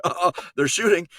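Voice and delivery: a mock-startled uh-oh. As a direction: caught off guard, pitch and volume jumping. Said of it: "little startling".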